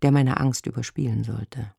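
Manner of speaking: ordinary delivery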